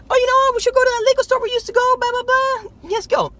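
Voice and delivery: high, fast, excited imitation of his wife; quoting wife. Man imitating his wife's eager liquor store idea, high and fast. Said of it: "And she's like".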